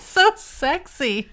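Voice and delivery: high, drawn-out mock swoon; hammed-up fangirl gushing; gushing girlfriends. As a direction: imitate a swooning friend, very slowly. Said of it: "all her little friends are like".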